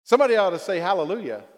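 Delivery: usual speaking voice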